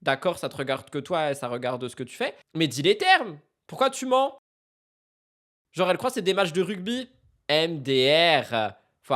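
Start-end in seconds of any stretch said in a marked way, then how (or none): 3.7-4.4 mock accusation. Real, not acted. Calling out streamer's lie.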